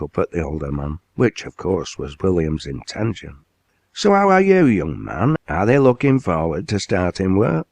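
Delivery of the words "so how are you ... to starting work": tower over him with a big hearty voice; booming mill owner. Acted. Owner quizzes new lad.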